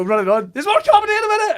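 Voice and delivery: high, loud, fast compere patter through a laugh; panicked compere holding the crowd, high and fast; frantic announcing. Retold or imitated. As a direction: gabble it out, desperately upbeat. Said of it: "And I go".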